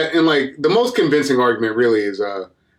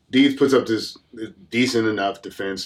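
normal talking voice

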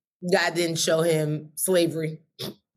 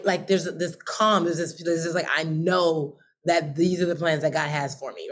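ordinary talk

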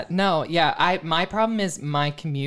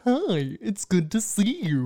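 put-on drawn-out, higher kids'-show voice; mimicked dinosaur greeting; 4 semitones higher, slower than usual. Copying the clip just played, in slow dinosaur voice. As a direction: imitate a TV dinosaur saying hello.

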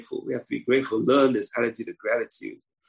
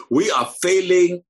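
slow, loud and higher, leaning on failing; emphatic shout; 6 semitones higher, 8 dB louder, slower than usual. Real, not acted. Rabbi rebuking his listeners, slow emphatic shout. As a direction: hammer home the failure.

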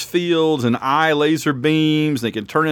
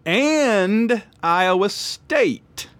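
very slow, high, stretched pick; drawn out; 7 semitones higher, much slower than usual. Real, not acted. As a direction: stretch it out slowly.

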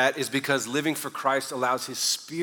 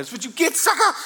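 high, loud gloating taunt; taunting brothers; 13 semitones higher, 4 dB louder than usual. Retold or imitated. Brothers taunting the bully, high and loud. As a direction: crow it over the beaten kid.

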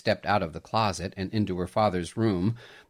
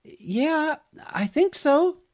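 dreamy answer; 4 dB louder, slower than usual. A narrator's reading. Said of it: "she heard herself answer, as if in a dream".

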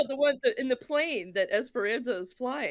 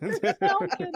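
laughing; 11 semitones higher, 3 dB louder than usual. Retold or imitated. Quoting the TV dub, laughing.

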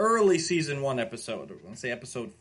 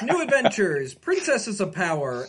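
ordinary delivery

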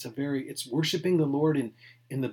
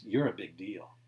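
lightly voiced tempter, lower and coaxing; a hint of a sly devil flattering; voicing Satan; 6 semitones lower, 5 dB quieter than usual. Pastor voicing Satan tempting Jesus, lightly lower and coaxing. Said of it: "Satan's trying to tell Jesus".

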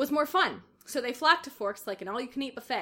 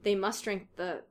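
ordinary delivery

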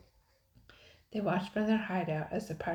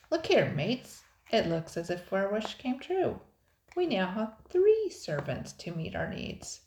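loud, higher, swaggering pirate voice; gloating pirate; 4 semitones higher, 6 dB louder than usual. A narrator's reading. Pirate shows mates three new servants, gloating. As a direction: crow over the catch to the crew.

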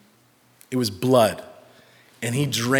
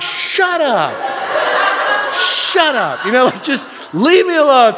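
high, loud, weepy snapping; hammed-up crying dad telling someone off; mock tearful outburst; 11 semitones higher, 6 dB louder than usual. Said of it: "just weeping uncontrollably".

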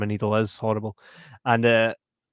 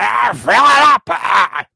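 loud, higher, rowdy yell; drunken bar shout; 10 semitones higher, 11 dB louder, slower than usual. Retold or imitated. Retelling Gideon demanding a refill, loud rowdy shout. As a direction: bark the order across the bar.

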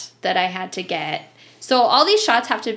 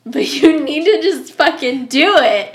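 loud, higher, emphatic demand; exasperated, raised-voice pleading; insistent retold order; 5 semitones higher, 9 dB louder than usual. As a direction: urge her on forcefully, half laughing. Retold urgent order to sister.

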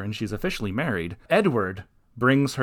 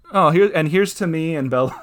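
mock toast; 6 semitones higher, 5 dB louder than usual. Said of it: "it was like".

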